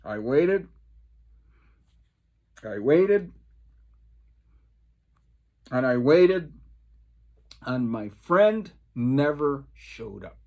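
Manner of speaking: ordinary talk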